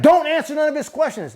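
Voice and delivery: high-pitched, insistent retold order; urgent interjection. Woman ordering the nurse not to answer, urgent interjection. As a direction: imitate her urgently cutting in. She cuts off the nurse again.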